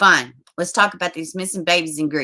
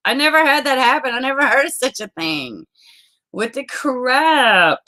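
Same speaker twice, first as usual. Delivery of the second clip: higher-pitched, exasperated disbelief; irritated; 5 semitones higher than usual. Real, not acted. Streamer baffled by the strike, irritated. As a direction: protest it, then drag out the last word. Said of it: "I am irritated".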